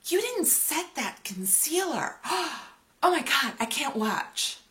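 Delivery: high, put-on scolding voice with a gasp; mock scolding commenter. Imitating a commenter scolding her makeup, hammed-up horror. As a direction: gasp and scold like a fussy critic. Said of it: "it's like they're scolding me".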